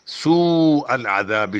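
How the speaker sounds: long drawn madd on سوء. Shown as a demonstration. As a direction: hold the long vowel of سوء. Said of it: "سین کو لمبا کیوں کیا گیا زیادہ".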